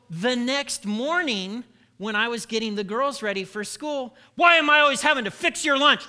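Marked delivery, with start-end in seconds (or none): 4.3-6.1 snappish, louder and fast; irritated dad snapping over lunch; barky. Retold or imitated. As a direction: bark it at the kids. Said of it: "I was barky".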